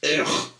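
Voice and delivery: loud, high groan of disgust; recoiling from filthy rubbish; disgusted ugh. A narrator's reading. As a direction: blurt out a revolted "ugh". Sunshine disgusted at collecting rubbish.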